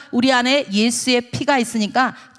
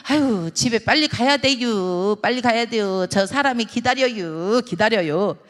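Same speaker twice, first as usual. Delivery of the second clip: Chungcheong dialect. Acted. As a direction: hedge unhurriedly in Chungcheong dialect. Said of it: "충청도 사람은".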